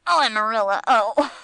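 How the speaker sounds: very loud, choking outburst of hilarity; choking with amusement. A narrator's reading. Gringo rolls on the rug at Amarilla, choking with amusement. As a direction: cry it out, overcome with laughter. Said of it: "he said chokingly".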